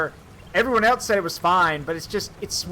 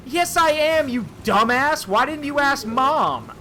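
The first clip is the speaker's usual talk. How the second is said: angry retort; 7 semitones higher, slower than usual. Retold or imitated. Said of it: "I literally said, and I quote".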